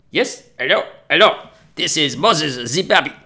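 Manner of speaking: hammed-up African accent demanding a passport; loud African accent impression pushing to be served; mock African accent. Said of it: "That's how they speak".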